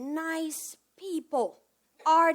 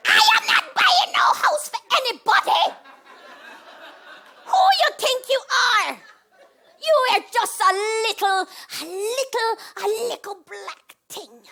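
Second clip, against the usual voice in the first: high, loud screeching rant; fishmonger's wife; 8 semitones higher, 11 dB louder than usual. Retold or imitated. Landlady refusing to pay her tenant, screaming high and loud. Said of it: "a fishmonger's wife".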